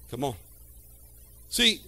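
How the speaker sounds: ordinary talk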